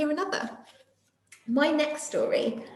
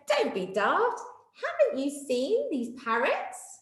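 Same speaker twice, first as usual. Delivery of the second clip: lightly higher teasing retort; mock laughing; 5 semitones higher, slower than usual. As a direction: gently tease. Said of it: "Day Monkey laughed and said".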